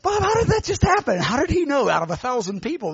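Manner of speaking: high, incredulous questioning; mock-amazed questioning of a friend; astonished question. Preacher quizzing Bob on what just happened, astonished.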